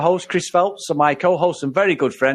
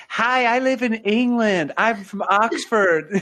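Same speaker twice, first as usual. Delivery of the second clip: slow, hammed-up English accent; bad English accent; 5 semitones higher, slower than usual. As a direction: overdo a fake posh English accent. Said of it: "Alicia Silverstone's, uh, English accent".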